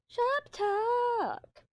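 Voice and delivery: very high silly squeal; goofy high shriek. Acted. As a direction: squeal it like a cartoon. Don't mind me, I'm an idiot.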